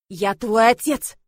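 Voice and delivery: slow, loud and angry; angry shout. Acted. Father angrily asserting himself to his daughter, loud. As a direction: lay down the law. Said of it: "разозлился мужчина".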